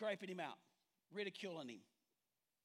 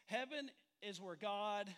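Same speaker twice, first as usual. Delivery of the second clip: slow lead-in; slower than usual. Shown as a demonstration. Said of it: "Are you ready for this?".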